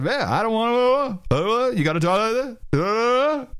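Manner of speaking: "uh" swooping up high with a backflip; hipster up-talk. Retold or imitated. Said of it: "kind of an up talk with a back, with a backflip".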